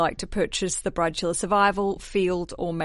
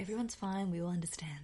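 whisper; 10 dB quieter than usual. Acted. Soothing the nervous audience.

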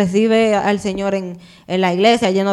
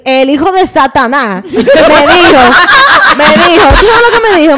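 loud, high, through real laughter; laughing outburst; 7 semitones higher, 9 dB louder than usual. Real, not acted. Woman telling friends about her ex's call, laughing outburst. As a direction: crack up while building to the reveal.

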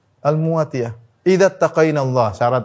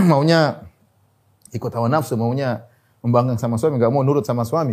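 usual speaking voice

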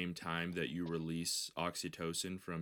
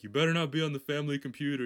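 loud, fast, raised-pitch telling-off; scolding grandfather; 7 semitones higher, 8 dB louder, faster than usual. Pretend. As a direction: pretend stern grandpa warning.

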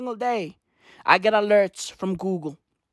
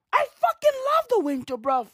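higher and faster, bursting out; excited declaration; 13 semitones higher than usual. Real, not acted. Scene: telling viewers he loves winter, higher, fast and excited. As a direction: blurt it out with glee.

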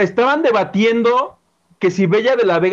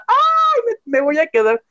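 grito imitado; 8 semitones higher than usual. Retold or imitated. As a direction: imitate an excited shriek, pitched way up. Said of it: "Bella empezó a gritar". (grito agudo de imitación, sin mucho volumen)

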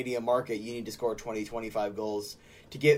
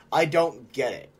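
usual speaking voice

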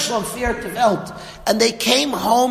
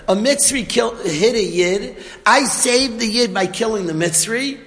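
ordinary talk

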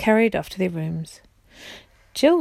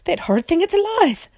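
high, louder horrified cry; screaming queen; 9 semitones higher, 4 dB louder than usual. Acted. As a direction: squeal it in disgust and fright. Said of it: "screamed the Queen".

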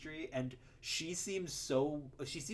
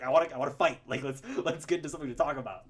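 louder, mock-combative; itching to argue; 7 dB louder, much faster than usual. Movie fan voicing Bill picking a fight, mock-combative. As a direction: imitate someone spoiling for a fight. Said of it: "you can tell he wants to argue".

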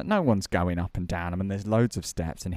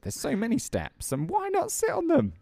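very high, incredulous rant; exasperated. Real, not acted. Ranting to co-host about the step warden, very high. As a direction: squeak out the disbelief.

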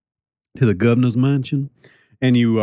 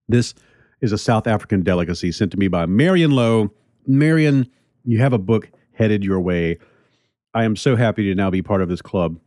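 ordinary talk, nothing marked

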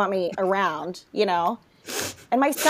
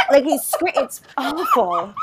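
ordinary talk

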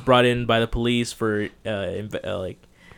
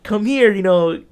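high, loud, coaxing call; come-here-kid bribe voice; luring coaxing adult; 6 semitones higher, 4 dB louder than usual. Retold or imitated.

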